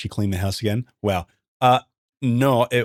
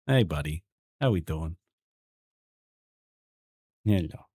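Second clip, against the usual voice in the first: ordinary delivery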